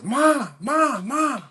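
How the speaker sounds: put-on high, eager child voice calling mom; be a six-year-old bursting with news; high kid voice stumbling over words; excited little boy. Kid running to tell mom.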